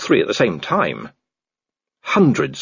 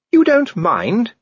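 much higher, slow, astonished lady's question; surprised Sybil; 14 semitones higher, 4 dB louder, much slower than usual. A narrator's reading. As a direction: ask in disbelief. Lady Sybil voiced.

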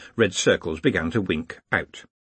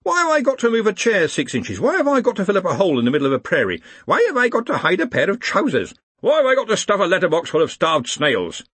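high, louder indignant questions; raised-pitch outcry; clamouring protest; 10 semitones higher, 5 dB louder than usual. A narrator's reading. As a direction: fire off complaints like a crowd of objectors. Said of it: "to silence the clamour".

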